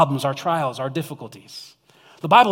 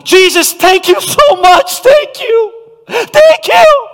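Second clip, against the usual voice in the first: shouted thanks, much higher and louder, building to a cry; loud and proud; 14 dB louder than usual. Shown as a demonstration. Preacher acting the healed man running back, shouting thanks. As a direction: yell your thanks to Jesus at the top of your lungs. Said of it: "He is loud and proud".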